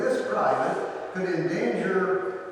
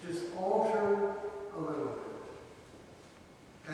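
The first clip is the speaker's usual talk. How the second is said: "a little bit" whispered very softly and slowly; hushed whisper; 3 semitones higher, 7 dB quieter, slower than usual. Shown as a demonstration. Preacher whispers "a little bit" to the congregation, very soft and slow. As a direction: breathe "a little bit" like a secret.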